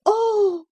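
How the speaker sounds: sharp high anguished "Oh!"; a bird's cry of despair. A narrator's reading. Cathy cries out as she finds the drawer empty. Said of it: "her single "Oh!".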